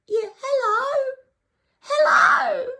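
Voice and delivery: very high, drawn-out holler; hammed-up squealing hello, stretched out; mock excited yell. Mimicking the man yelling across the queue, excited shriek. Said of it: "he yells out".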